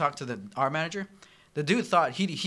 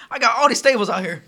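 mock exasperated shout; 8 semitones higher, 10 dB louder than usual. Continues outburst.

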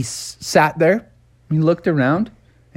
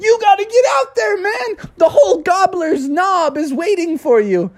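protesting bear. Acted. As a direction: cry out to stop them. Bubby urges Phil.

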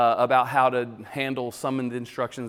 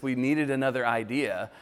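ordinary talk, nothing marked

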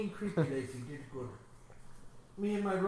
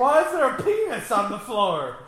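high, loud, laughing question; mock-panicked laughter; 7 semitones higher, 10 dB louder than usual.

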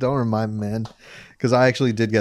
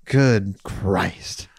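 low, slow mock-solemn exclamation; drawn-out exclamation; 5 semitones lower than usual. Podcaster repeating himself to co-host, low drawn-out exclamation. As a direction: stretch both words out, pitched down.

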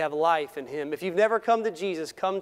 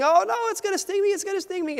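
high, fast frightened squeal; mock terror of a small boy; boy panicking at a bee, much higher; panicked child; 3 dB louder, faster than usual. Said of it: "the little boy was screaming".

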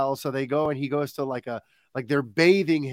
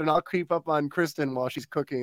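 ordinary delivery

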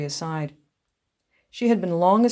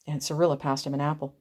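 usual speaking voice

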